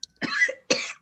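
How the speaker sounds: harsh cough in thick gas; choking cough. Acted. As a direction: cough hard, choking on fumes. Leader choking on ammonia.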